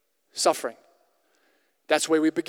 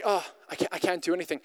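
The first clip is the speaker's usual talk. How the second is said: soft, deflated, hope gone; a hushed, beaten-down sigh of a line; defeated murmur; 2 semitones lower, 7 dB quieter, faster than usual. Retold or imitated. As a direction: let it sink quietly like you've given up. Heartbroken person giving up.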